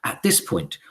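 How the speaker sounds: usual speaking voice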